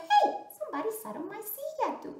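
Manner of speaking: high, fast mama bear; quick, high-pitched indignant mother bear. Acted. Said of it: "also mama said".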